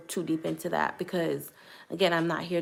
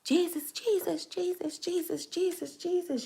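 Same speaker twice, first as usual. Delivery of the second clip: much higher, pleading chant without full volume; imitated screaming; 11 semitones higher, 4 dB quieter than usual. Retelling herself tarrying on the pulpit, imitated screaming. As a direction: reenact a frantic scream, high but held back in volume. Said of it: "I start screaming".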